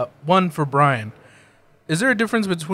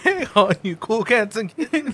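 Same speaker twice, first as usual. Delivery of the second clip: mock Carole Baskin greeting; 7 semitones higher than usual. Quoting Carole Baskin catchphrase.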